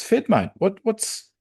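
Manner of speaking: high-pitched puzzled concern; concerned questioning. Acted. Coach checking on his upset player, high concerned voice. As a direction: ask haltingly, pitched well up. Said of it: "he goes over to Fed and goes".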